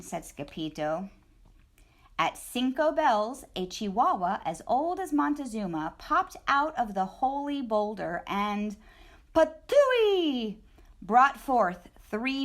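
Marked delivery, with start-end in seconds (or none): none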